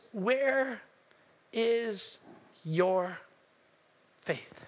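"Where... is... your... faith?" with a pause after every word; word-by-word reproach. Retold or imitated. Jesus to disciples, "Where is your faith?" word by word, falling away.

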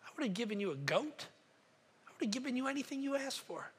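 father softly promising his son everything; tender reassurance. Acted. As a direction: speak it like a father holding his child.

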